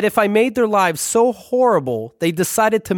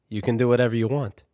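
lower, softer big-brother voice, a bit quick; lightly voiced patient older brother; 6 semitones lower, 5 dB quieter, faster than usual. Brother teaches kid Dungeons and Dragons, lower patient voice.

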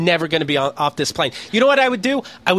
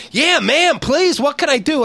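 over-eager pleading; 7 semitones higher than usual. Retold or imitated. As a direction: beg her like a desperate fan. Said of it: "I'm like".

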